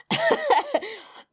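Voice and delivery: high burst of laughter; real laugh. Real, not acted. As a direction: break into a loud, high laugh. Laughs at skipping the accent.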